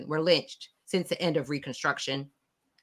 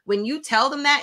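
ordinary delivery

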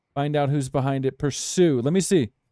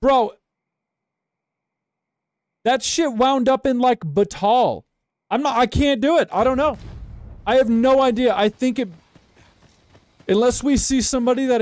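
exasperated; 6 semitones higher than usual. Real, not acted. Bewildered rant about lost target.

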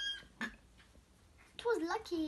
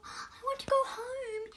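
higher, tearful whimper; 7 semitones higher than usual. Acted. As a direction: whimper it, losing courage. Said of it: "he whimpered".

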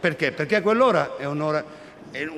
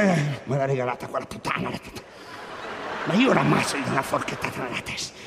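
sleep talking; 5 semitones lower, 5 dB quieter than usual. Retold or imitated. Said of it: "lui nel sonno comincia".

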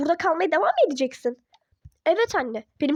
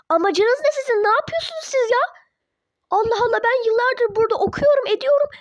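angry outburst; 5 semitones higher than usual. Acted. Daughter shouting at her parents in private. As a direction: confront them, fed up.

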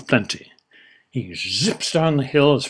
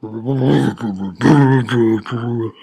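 loud booming laugh; hearty laugh; 5 dB louder than usual. Acted. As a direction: laugh out big. Character laughing.

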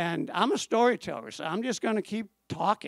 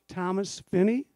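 usual speaking voice

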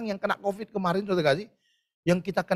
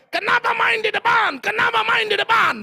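loud, high scolding mother; mother shouting at her child; angry scolding; 12 semitones higher, 7 dB louder than usual. Acted. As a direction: scold the muddy kid.

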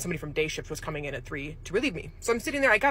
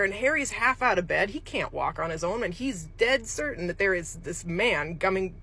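ordinary delivery

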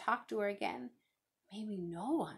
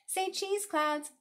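high, loud playful call; bright sing-out in a high child voice; child playing photographer; 11 semitones higher, 5 dB louder, much slower than usual. A narrator's reading. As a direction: call out to pose the subject.

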